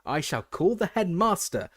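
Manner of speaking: teacher shouting a threat up at Peeves; shouted. A narrator's reading. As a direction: threaten him at full voice. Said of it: "shouted Professor McGonagall".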